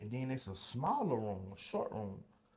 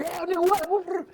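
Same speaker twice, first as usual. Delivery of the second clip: high, loud threatening yell; aggressive shout; 11 dB louder than usual. Retold or imitated. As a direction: bark it down the gun barrel. Guards drawing paintball guns.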